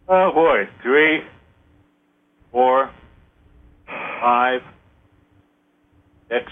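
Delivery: calm guided counting; slow ritual count. Acted. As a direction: count slowly, pausing between numbers.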